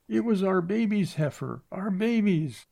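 high, tearful wail; high sobbing lament. A narrator's reading. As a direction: break down over the lost heifer. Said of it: "She sobbed".

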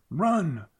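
high urgent shout; yelled. A narrator's reading. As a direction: shout the order to run. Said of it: "Sloan yelled".

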